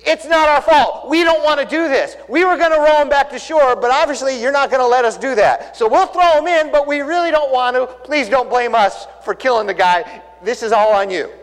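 high, loud, frantic begging to God; desperate pleading prayer. Retold or imitated. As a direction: plead loudly like terrified sailors.

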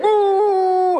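high, sudden whoosh of a car taking off; imitated car roar. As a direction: mimic the car shooting away. Car speeding off again.

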